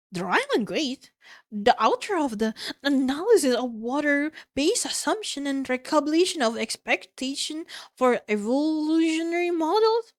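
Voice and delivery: incredulous recognition. Acted. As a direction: build disbelief through the long title. Astonished character recognises notorious author.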